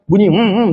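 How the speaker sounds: a muffled hmm with the lips shut; a swear word held back behind closed lips; humming with closed mouth. Shown as a demonstration. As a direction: hum the word instead of saying it. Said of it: "Jadi tak bunyi juga babi tu".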